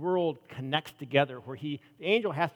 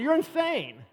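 high, mock disbelief; incredulous; 11 semitones higher, 3 dB louder, slower than usual. Believers answering Rhoda, high mocking disbelief. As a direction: exclaim that she's insane.

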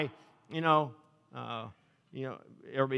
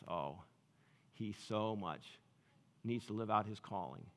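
tender Jesus; 6 semitones lower, 10 dB quieter than usual. Retold or imitated. Jesus speaking tenderly of Zacchaeus.

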